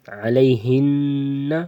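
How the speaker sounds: علیہن drawn out with a long nasal n; ghunna with joining. Shown as a demonstration. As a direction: let the n of علیہن ring through the nose. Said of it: "ہمیشہ غنہ ہوگا". (وصل میں علیہن، غنہ کے ساتھ)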